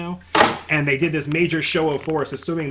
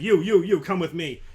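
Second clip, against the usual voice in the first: barked orders; 4 semitones higher, 4 dB louder than usual. Acted. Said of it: "shouting orders and just like".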